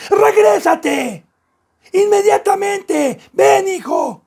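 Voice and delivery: high, slow, shouted orders; urgent shouted command. Retold or imitated. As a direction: call the child back, hard. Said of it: "con esa voz".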